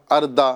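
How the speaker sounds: اردا with a plain d in place of ض; اردا as a learner's slip, light dal sound; mispronounced dal. Shown as a demonstration. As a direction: say اردا wrongly, ض turned into dal. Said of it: "یا دال کی طرح".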